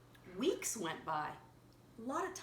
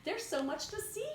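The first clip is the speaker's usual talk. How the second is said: excited caterpillar; 11 semitones higher than usual. Acted. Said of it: "she said".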